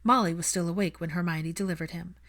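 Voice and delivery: ordinary delivery, nothing marked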